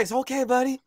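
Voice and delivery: high, hushed-down comforting; reassuring dad. Acted. Hurt father to his sorry son, high and reassuring. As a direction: soothe the child after being hurt.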